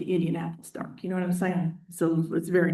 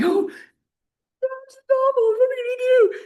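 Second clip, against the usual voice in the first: put-on much higher, slow squealing girls; panicked girls; 3 dB louder than usual. Teacher imitating students finding crabs, high panicked squeal. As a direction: mimic the shrieking students. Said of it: "the girls are like".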